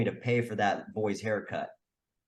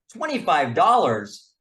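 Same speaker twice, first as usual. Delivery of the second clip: shocked at price; 6 semitones higher, 8 dB louder, slower than usual. Retold or imitated. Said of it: "mother reacts to the price".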